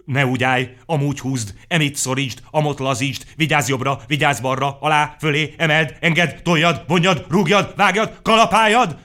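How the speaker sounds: loud, high, rising barrage of commands; a crowd shouting bossy instructions; shouting orders. A narrator's reading. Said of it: "kiabálni kezdtek".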